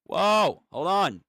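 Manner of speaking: ordinary talk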